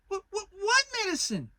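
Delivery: frightened little piglet; alarmed Piglet. A narrator's reading. Piglet alarmed at Kanga's medicine, high stammer.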